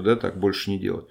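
ordinary talk, nothing marked

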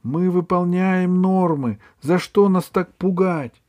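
high squeaky little gnome; squeak. A narrator's reading. Пискнул гном.

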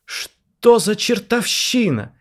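very high, loud, slow yell; guard yelling in shock; shouting across the hall. A narrator's reading. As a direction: scream it across the room. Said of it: "закричал на весь зал Леонид".

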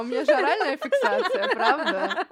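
high, loud laughing voice. Real, not acted. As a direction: giggle through the words. Joking through laughter.

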